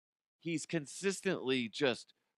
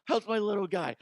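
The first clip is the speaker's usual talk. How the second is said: ref cooing; 8 semitones higher than usual. Retold or imitated. Ref comforting LeBron, mock cooing baby talk. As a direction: coo at him like a toddler. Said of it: "This is the ref talking".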